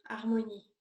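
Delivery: usual speaking voice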